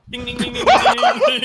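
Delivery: bell ding imitation. Mimicking wheelchair bell.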